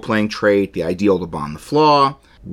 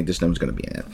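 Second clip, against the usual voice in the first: ordinary talk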